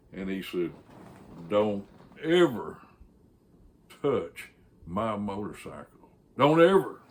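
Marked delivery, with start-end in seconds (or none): 1.5-6.1 deliberate, measured threat; stern warning. Retold or imitated. Biker warns boss over ticket. 6.4-7.0 high, loud repeated warning; punched, forceful order; emphatic warning. Retold or imitated. Biker repeats warning to boss.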